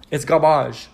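garbage said French-style as gar-BAHZH; mock-French verdict, gar-BAHZH; mock French accent. Shown as a demonstration. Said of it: "how do they say it in Fr-, French".

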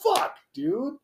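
high, whiny protest; exasperated retold curse. Driver answering the friend who got him ticketed, exasperated. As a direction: curse in helpless, high-pitched frustration.

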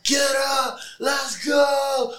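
high, loud rallying shout; hyping the crowd. Retold or imitated. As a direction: re-enact firing up the stands.

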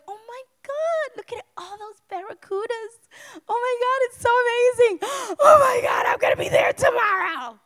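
high, loud gushing excitement, gasping; excited squeal. Retold or imitated. Wife on the cruise deck gushing over barracudas, high excited squeal. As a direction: gush like a thrilled tourist.

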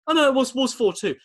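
pundit correcting himself in a fluster; imitating Jack Charlton. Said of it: "He goes".

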